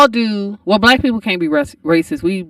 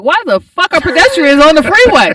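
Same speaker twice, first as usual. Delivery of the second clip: higher, louder retold disbelief; incredulous outburst; 6 semitones higher, 5 dB louder than usual. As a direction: rant it in comic bewilderment. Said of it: "first thing in my mind is like".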